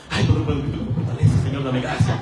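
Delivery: fast, low hurried prayer of panic; mock panicked prayer. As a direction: rattle off a desperate prayer. Late worker praying in panic.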